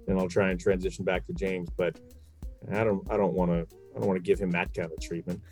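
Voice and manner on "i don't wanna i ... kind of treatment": normal talking voice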